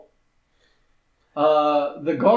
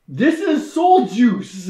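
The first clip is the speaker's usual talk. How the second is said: raised, drawn-out declaration with laughter; mock dramatic announcement; 5 semitones higher, 4 dB louder, slower than usual. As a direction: voice an over-dramatic character, slowly, breaking into laughs. Mock protest of film character.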